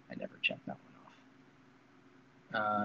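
breathy confessional whisper, voice dropped right away. Real, not acted. Telling chat he ignores the checklist, in a whisper. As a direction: whisper it like a guilty secret to chat. Confessing the rule he always breaks.

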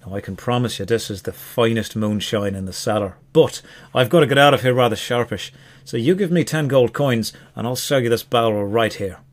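put-on Irish-accented salesman's patter; Irish elf. Elf hawks a barrel of moonshine to the barbarian, Irish accent. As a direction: pitch the goods in an Irish brogue. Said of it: "the elf is Irish".

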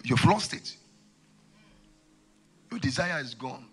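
ordinary talk

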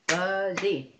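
"fuzzy" split into two clapped beats, fuz-zy; clapped syllables. Shown as a demonstration. Teacher clapping syllables for children, loud and even. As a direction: clap out "fuzzy" beat by beat. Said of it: "So let's clap for fuzzy".

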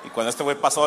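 usual speaking voice

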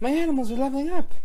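high, fast and loud; thrilled at the news; excited. Real, not acted. Gasps at leveling up.

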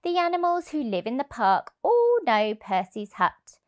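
ordinary delivery

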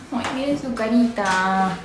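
normal talking voice

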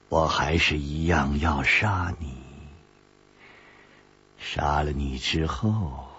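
put-on low, slow old woman granting leave; a deep, measured crone; old woman's voice. A narrator's reading. Old woman threatens him.

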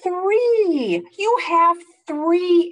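high, loud final count; excited count. Acted. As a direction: land the last number big. Counting eggs, climax.

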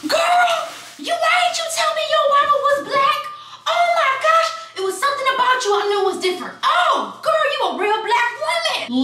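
hammed-up high shriek of amazement; loud, squealing mock astonishment; astonished teacher. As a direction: imitate a thrilled woman exclaiming, voice sky-high. Imitates teacher's astonished, delighted discovery.

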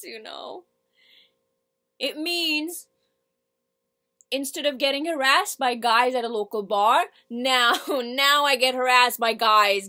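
loud, mounting tirade; monologue character, fed up. Acted. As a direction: rant it out loudly, hammering the repeats.

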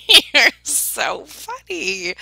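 loud laugh breaking into drawn-out words; loudly cracking up; real laughter. Real, not acted. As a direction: laugh your way through it, slowly. Laughing at a fan's message.

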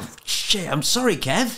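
mock apology. Mock apology for botching comedian's joke.

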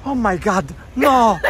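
high, loud burst of excitement; excited shout. Real, not acted. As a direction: shriek it with delight. Excited reaction to Miami.

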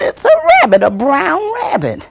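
very high, loud exasperated insisting; child insisting to granddaddy, very high. Retold or imitated.

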